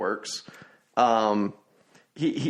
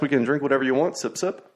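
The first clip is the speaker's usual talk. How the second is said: ordinary delivery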